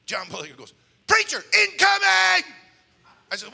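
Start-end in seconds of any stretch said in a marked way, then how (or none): 1.1-2.5 high, loud, slow warning yell; alarmed shout. Retold or imitated. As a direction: yell a warning as bullets fly. Warning shout as shots fly.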